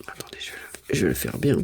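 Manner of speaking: quiet, unvoiced, absorbed; whispered concentration. Real, not acted. To viewers while writing neatly, whispering.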